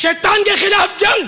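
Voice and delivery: loud, high, drawn-out slogan call; rallying slogan shout. Real, not acted. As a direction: shout it out to rouse the crowd. Slogan call for war on Satan.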